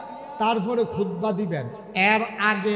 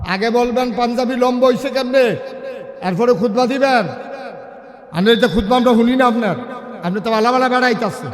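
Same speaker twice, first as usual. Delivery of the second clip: loud, raised-pitch challenge; confrontational shout; 5 semitones higher, 7 dB louder than usual. Acted. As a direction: demand an answer in front of everyone. Acts challenger interrupting the khutba. (চড়া গলায় জবাবদিহি চাওয়া)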